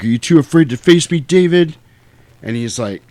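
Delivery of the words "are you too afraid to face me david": higher, louder hero's dare; mock defiant challenge. Michael dares flying David to face him, defiant. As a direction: call him out boldly.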